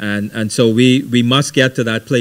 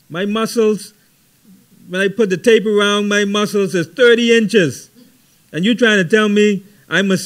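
higher, hammed-up boastful brawny man; mock tough guy; 9 semitones higher, 3 dB louder than usual. Preacher mocking the macho man who can't say he loves God, boastful. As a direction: puff up like a bragging worker.

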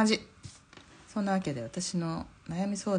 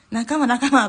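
loud, high cry of fellowship; raised, punchy mock cheer; retold happy hailing; 6 semitones higher, 8 dB louder, slower than usual. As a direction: call out to your new comrades, imitating them. Retold group hailing each other as fellows.

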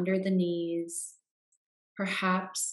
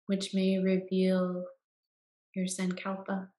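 guided meditation. Acted. Guide leading listeners into Yoga Nidra rest, calm meditation voice.

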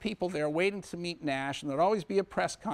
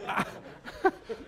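breathy silent laugh; 5 semitones higher, 3 dB quieter than usual. Real, not acted. Laughing at own joke.

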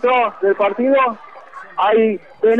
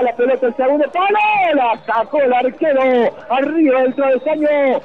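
normal talking voice

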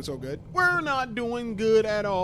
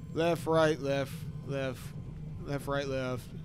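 muttering to himself; 4 semitones lower, 7 dB quieter, slower than usual. Real, not acted. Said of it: "Let me remember".

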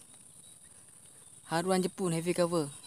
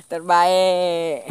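loud drawn-out praise; 12 dB louder than usual. Real, not acted. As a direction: call it out proudly. Praising the catch. (pujian lantang)

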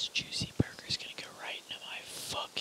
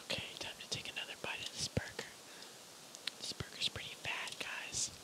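whispering. Real, not acted. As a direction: whisper close to the mic. ASMR eating video.

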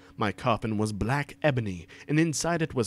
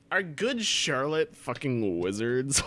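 plain reading voice

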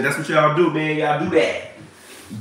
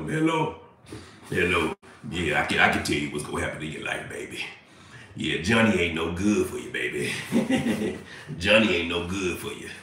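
low, soft hotline dude; Teddy; 10 semitones lower, 9 dB quieter than usual. Acted. Fake hotline dude tells a caller her fortune, low mock voice. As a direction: play a fake psychic, low and offhand. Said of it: "some dude named Teddy on the other line".

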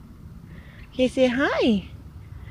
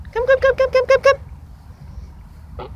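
high calling; 13 semitones higher, much faster than usual. Real, not acted. Calling goose over.

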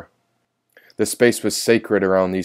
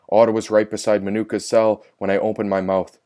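plain reading voice

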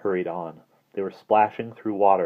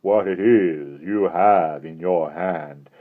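slow, louder, mildly insidious menace; 7 dB louder, much slower than usual. Acted. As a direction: coax them with a creeping threat, drawn out. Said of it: "gentle, insidious".

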